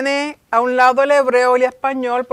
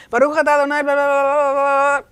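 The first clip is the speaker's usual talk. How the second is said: louder, mock careless gabbling of a prayer; mindless rattled-off prayer; 3 semitones higher, 5 dB louder than usual. Preacher mocking those who rush prayers, careless gabble. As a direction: rattle it off like it means nothing. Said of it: "lo hace en diez segundos".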